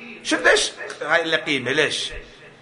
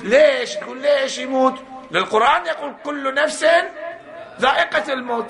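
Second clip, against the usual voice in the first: heated preaching; 7 semitones higher than usual. Real, not acted. Rising preaching climax.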